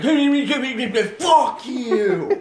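put-on loud, high, slow drunken taunt; drunk jeering. Imitating drunk friend on couch, loud taunt. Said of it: "just be like".

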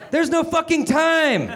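high, drawn-out strained snap; snapped. Acted. Calvin cutting off Meg's questions about space, high exasperated snap. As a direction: snap it high, stretching the words. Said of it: "Calvin O'Keefe snapped".

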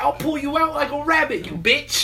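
high-pitched hammed-up menace; mock magician threat. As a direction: threaten like a ridiculous kid magician. Mimicking angry magician kid's threats.

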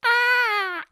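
very high squealing cat yowl. Acted. Host playing a cat, crying out in a high yowl. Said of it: "I'm Adolf Hitler, the cat".

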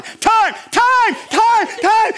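frantic, very high repeated shouts; yelling. Acted. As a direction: yell desperately for the ref's attention. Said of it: "I'm yelling time".

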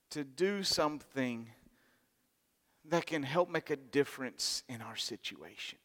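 slow, soft preaching; soft sermon close. Acted. Preacher softly drawing the lesson for the congregation. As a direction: bring it down gently to the lesson.

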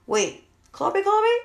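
slowly working out who they mean; confused double-take. Retold or imitated. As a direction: mom puzzled about who Kobe is. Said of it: "And I'm like".

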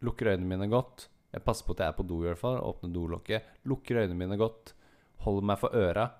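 normal talking voice

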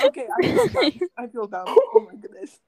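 high breaking giggles; laughing at himself. Real, not acted. Giggle fit over sus coins.

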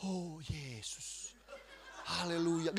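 soft, drawn-out devotional murmur; slow, hushed worship demo; demonstrated prayer. Retold or imitated. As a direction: imitate someone teaching a slow prayer. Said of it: "terus bilang aja gini".

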